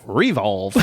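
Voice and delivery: "revolve" said as REE-volve; corrected noun stress. Shown as a demonstration. Correcting the church name jokingly, "revolve" stressed on first syllable. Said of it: "It should be".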